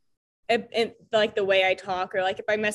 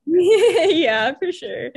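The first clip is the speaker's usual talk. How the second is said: laughing; 7 semitones higher, 7 dB louder, much slower than usual. Real, not acted. Laughing reply.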